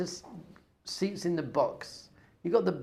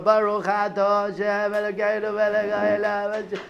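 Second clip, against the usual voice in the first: blessing recited; 4 semitones higher, 7 dB louder than usual. Acted. Father making the rare blessing over fruit, chanting fervently. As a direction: chant the Hebrew blessing out loud.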